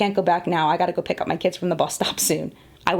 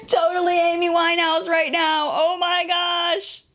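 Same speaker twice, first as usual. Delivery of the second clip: mock dismay; 10 semitones higher, slower than usual. Makeup mishap, mock dismay.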